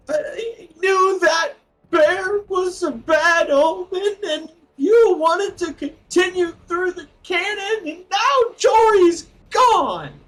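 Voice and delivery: high, loud, slow crying shout; sobbing wail. Acted. Grieving woman wails to the wizard through sobs. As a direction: wail it out through sobs over a lost friend. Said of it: "you notice that through her sobs".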